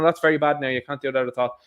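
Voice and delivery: ordinary talk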